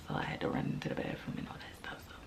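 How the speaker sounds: whispered embarrassed aside; whispering. Real, not acted. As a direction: whisper it sheepishly. Embarrassed aside about bathroom.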